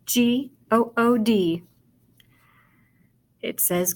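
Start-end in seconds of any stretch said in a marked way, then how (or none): 0.0-1.6 slow "G O O D", one letter at a time; spelling aloud. Shown as a demonstration. Teacher spelling good for pupils, spelling aloud. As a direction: draw out each letter of "G O O D". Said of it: "Let's spell it again".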